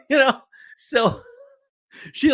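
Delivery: high laughter through the words; real laughter cracking up; laughing. Real, not acted. Teller cracking up at the memory, laughing.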